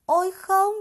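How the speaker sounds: high, loud gasp of shock; shocked cry. A narrator's reading. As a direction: cry out in alarm. Child shocked by the wound. (khỉ con thốt lên hoảng hốt, cao và to)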